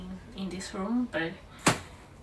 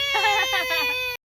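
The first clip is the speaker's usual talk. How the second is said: high delighted squeal-laugh; 6 dB louder than usual. Real, not acted.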